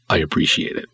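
whispered, dry thanks; soft sarcastic aside; snarky whisper. Real, not acted. Filmmaker mock-thanking a reviewer, in a whisper. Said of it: "I'll stop being snarky".

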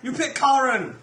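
hammed-up fake accent, shouted through laughs; mock Chinese accent. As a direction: yell it in a bad caricature accent. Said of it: "Slave owner is turning Chinese".

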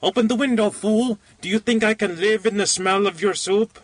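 very high-pitched aged shriek; old person's shriek. A narrator's reading. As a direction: shriek it as a cranky old invalid. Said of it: "The voice of age arose to a shriek".

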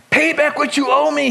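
grab him and demand it; harsh demand. Acted. Servant choking his fellow servant, demanding his money.

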